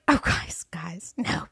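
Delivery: whispered protest; retold whisper. Whispering to the crew, urging them to go home. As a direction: hush it out, pleading softly.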